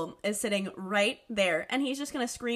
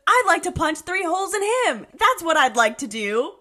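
loud, higher-pitched mock outrage; mock indignant; 5 semitones higher, 7 dB louder than usual. Carly venting about her strict teacher, mock indignant. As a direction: imitate an indignant threat at full volume. Acting out Carly's angry threat.

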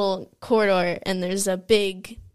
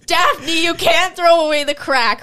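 mock public call-out, loud and higher; imitating dad calling out; 5 semitones higher, 6 dB louder than usual. Daughter imitates dad on the street, calling out loudly. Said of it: "He literally walked down the street going".

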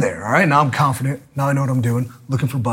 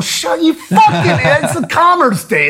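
angry outburst; 12 semitones higher than usual. Retold or imitated. Ranting about the bike store closed on Sunday, angry then laughing. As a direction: blow up at them, then crack up.

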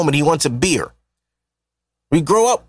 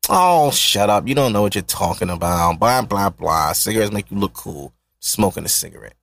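dismissive mock; 10 semitones lower than usual. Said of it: "They told this bitch when she was young".

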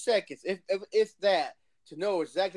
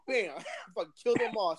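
ordinary delivery

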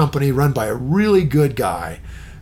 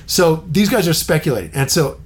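ordinary talk, nothing marked

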